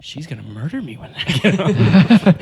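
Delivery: whispered, half-laughing dread; mock dread whisper. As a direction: whisper it like you're doomed. Retelling his fearful thought.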